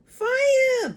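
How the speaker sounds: put-on very high, loud panicky old hag shout; shouting Grandma. A narrator's reading. Grandma panics and shouts for the fire brigade. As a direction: yell it like your stomach's burning. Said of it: "the old woman yelled".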